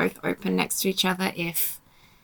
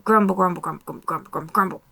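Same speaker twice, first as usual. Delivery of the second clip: mock grumbling; much faster than usual. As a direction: grumble away under your breath, in jest. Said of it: "I'm gonna grumble about it".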